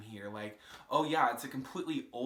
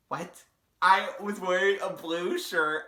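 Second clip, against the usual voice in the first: louder, higher, slow protest; mock-offended correction; indignant; 6 semitones higher, 9 dB louder, slower than usual. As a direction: huff it out, offended. Indignant rebuttal of insult.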